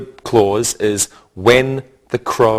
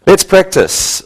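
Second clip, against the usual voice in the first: upbeat call; 8 semitones higher, 5 dB louder than usual. Real, not acted. Teacher rallies class to practice.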